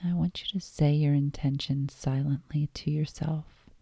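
calm meditation voice with long pauses; guided meditation. Acted. Host guiding listeners lying down into yoga nidra, guided meditation. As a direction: guide them gently inward, leaving space between phrases.

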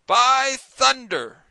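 slow, loud, high exclamation; a drawn-out furious shout. A narrator's reading. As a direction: bellow it out in outrage. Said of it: "Ned Land shouted".

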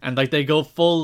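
ordinary talk, nothing marked

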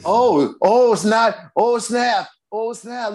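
high, drawn-out delighted exclamation; real thrill at seeing the new beer; excited oh snap. Real, not acted. Reacts to the beer shown.

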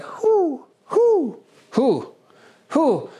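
high falsetto who who, like a question; playful high owl asking who; questioning owl hoots. Retold or imitated. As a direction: hoot each who as if asking. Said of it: "sounds like they're asking a question".

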